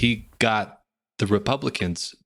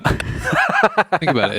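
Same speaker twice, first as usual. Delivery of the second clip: mock conspiratorial; 9 semitones higher, 3 dB louder, slower than usual.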